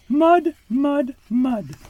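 put-on very high, sulky baby duck voice; grumpy duckling. A narrator's reading. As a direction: whine each word like a cross duckling. Baby Duck repeats complaint.

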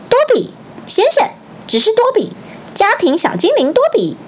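very high, fast elf voice; a tiny elf gushing at speed; squeaky house-elf. A narrator's reading. Dobby gushing to Harry, high and fast.

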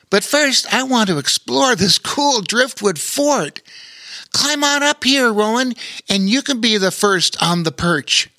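put-on high excited little boy; young boy voice. A narrator's reading. Lore inviting Rowan onto the fort, high boyish voice. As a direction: call Rowan up with enthusiasm.

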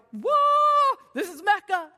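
big high, loud gasp of delight; stunned with joy at the pens; wide-eyed, thrilled discovery; amazed whoa. Retold or imitated. Amazed in the store.

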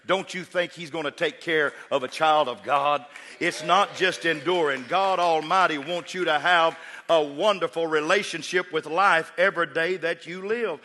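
loud, high preaching climb; preaching at full force. Acted. Preacher urging the congregation toward joy, loud and rising. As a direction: drive it home to the congregation.

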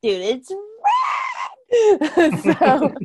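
loud, high, laughing shout; excited shout. Real, not acted. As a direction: yell it out, thrilled. Host thrilled, shouting praise.

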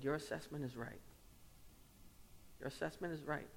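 soft, gentle retelling of a mentor's reassurance. Retold or imitated. Mentor to a pastor who confessed aggression, gently. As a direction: quote him softly, letting it sink in. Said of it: "in a gentle way, he said".

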